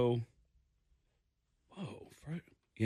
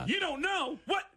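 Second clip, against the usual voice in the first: high, exasperated echo; incredulous cop; 13 semitones higher than usual. Retold or imitated. Cop hearing a second dumb answer, incredulous. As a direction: imitate an officer in disbelief.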